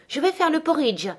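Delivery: high-pitched, sudden announcement; sharp high cry. A narrator's reading. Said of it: "criant sur un ton aigu".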